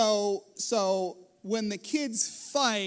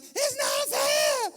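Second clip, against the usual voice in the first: put-on high, loud, stretched-out child whine; slow shrieking "It's not fair" whine; whiny kid shout; 5 dB louder, much slower than usual. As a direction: wail it like a bratty kid. Kids whining about chores.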